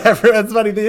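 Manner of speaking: high laughing comment. Real, not acted. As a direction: say it through a real laugh. Laughing reaction.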